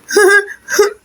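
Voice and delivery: a brief amused giggle; short laugh. Acted. Friends laugh at Chintu made into a witch, short giggle.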